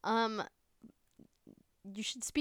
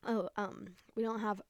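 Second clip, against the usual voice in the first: ordinary talk, nothing marked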